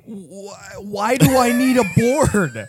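high-pitched incredulous question; mock incredulous outcry. Host questions the Holy Spirit Board, incredulous outcry.

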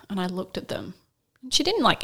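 usual speaking voice

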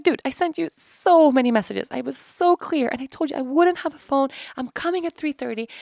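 high, emphatic retold protest; exasperated. Daughter reminds mom of her messages, exasperated. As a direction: lean on each point, indignant.

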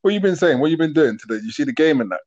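ordinary delivery

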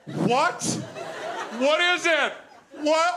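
rising high puzzled "What?"; confused. Retold or imitated. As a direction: act baffled by the billboard. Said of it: "I was like".

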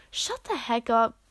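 ordinary delivery, nothing marked

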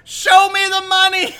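laughing mock yell; mock frantic yell. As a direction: scream it like a desperate agent. Reciting Jerry Maguire quote.

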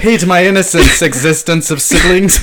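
mock battle cry. Friend misquoting the line to yell at the truck, mock battle cry.

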